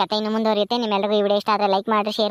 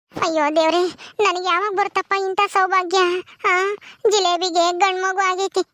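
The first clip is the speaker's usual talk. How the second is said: higher, lively village woman by the newborn; village women voices; 6 semitones higher than usual. Acted. Childless Gauri watching the baby, wistful and longing. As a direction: warm then wistful as she longs for a child.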